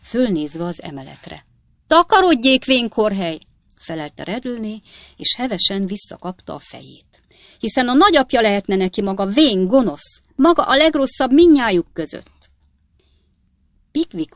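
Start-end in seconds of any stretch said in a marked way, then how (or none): 1.9-3.4 high, loud shout; outraged landlady's yell; furious dismissal. A narrator's reading. Landlady drives off old gentleman. 7.6-12.5 loud, high landlady's tirade; indignant, raised-voice scolding; angry abuse. A narrator's reading. Landlady abuses old Pickwick.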